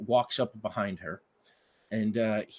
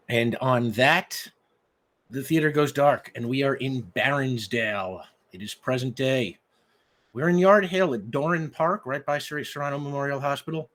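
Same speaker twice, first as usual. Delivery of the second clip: dramatic scene setting; 3 semitones higher, 4 dB louder than usual. Acted. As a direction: open the scene like a curtain rising. GM sets the opening scene.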